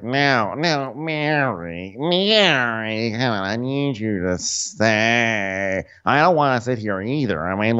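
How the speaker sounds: slow, drawn-out mock plea; slow mock whining. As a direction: plead with her wearily. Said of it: "Meredith is like".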